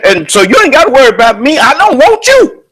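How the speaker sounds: ordinary delivery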